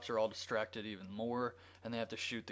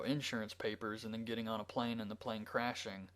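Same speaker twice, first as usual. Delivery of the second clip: usual speaking voice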